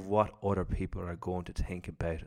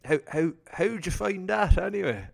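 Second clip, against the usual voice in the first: nervous stammer, pitched way up; shaky, stammering question, much higher; shivering voice; 9 semitones higher, 6 dB louder than usual. Retold or imitated. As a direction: stutter it out like your nerves are shot. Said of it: "the voice just shivers out".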